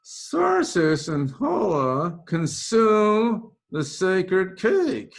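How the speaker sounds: high, loud, slow heavenly voice; mock divine narrator; narrator from above. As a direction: boom it down from the sky. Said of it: "pipes in from above".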